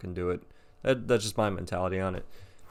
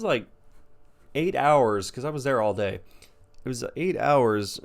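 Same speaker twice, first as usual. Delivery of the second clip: usual speaking voice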